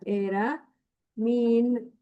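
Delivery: normal talking voice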